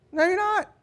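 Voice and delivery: very high-pitched sing-out retort; mock contradiction. Onlooker doubting that they're fine, high mock contradiction. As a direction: call the bluff way up high.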